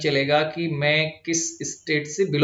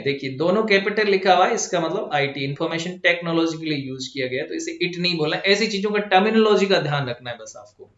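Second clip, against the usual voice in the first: ordinary talk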